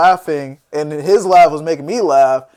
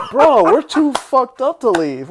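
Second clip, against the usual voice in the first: laughing; 6 semitones higher, slower than usual. Real, not acted. Friend told to leave, laughing.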